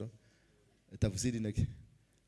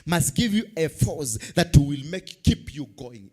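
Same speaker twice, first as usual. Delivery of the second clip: slow, loud preaching over music; tv preacher; 3 semitones higher, 8 dB louder, slower than usual. Acted. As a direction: stretch each word, preach it.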